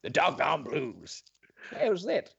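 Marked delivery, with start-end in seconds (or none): 0.0-1.2 slow, low gravelly dog voice; low gravel vigilante growl. Retold or imitated. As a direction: growl it like a gruff old dog. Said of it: "Very good, very good".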